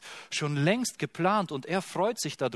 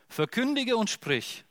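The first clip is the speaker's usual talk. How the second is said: commanding, higher call to proclaim; scripture proclamation; 9 semitones higher than usual. A narrator's reading. Angel commands the prophet, ringing proclamation. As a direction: ring it out like a prophet's charge. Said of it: "der sprach zu mir".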